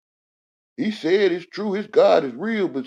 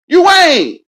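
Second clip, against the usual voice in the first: "You ain't" yelled much higher and louder; shouted insistence, much higher and louder; sudden shout; 15 dB louder than usual. Acted. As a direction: blast "You ain't" out at the top of the voice. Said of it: "You ain't stupid".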